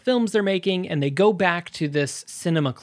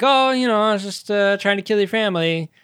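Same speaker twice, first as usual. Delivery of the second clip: higher, mock-offhand admission; mock casual; 5 semitones higher, 3 dB louder than usual. Gangster explaining himself to the child, mock casual. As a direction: toss off a retold confession lightly, starting high. Acted offhand villain's reply in retelling.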